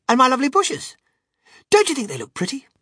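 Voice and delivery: high, loud gushing questions; excited cry. A narrator's reading. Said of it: "he cried".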